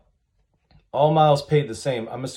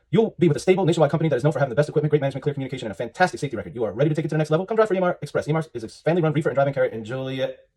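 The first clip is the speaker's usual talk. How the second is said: rapid read-through; much faster than usual. Shown as a demonstration. Trucker skimming a company's job posting aloud, rapid-fire. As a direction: rattle through it very fast.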